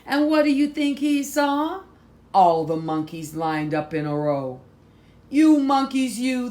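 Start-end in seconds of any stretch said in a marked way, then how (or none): 0.1-1.8 put-on high, loud rising question to children; excited question. A narrator's reading. Storyteller asks children. 5.3-6.5 high, loud scolding of the monkeys; mock scolding.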